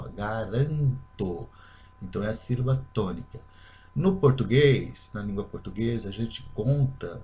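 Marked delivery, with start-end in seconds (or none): 0.0-1.5 "garanto" drawn out slowly on RAN; stressed syllable demo. Shown as a demonstration. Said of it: "onde a gente fala mais forte, ó".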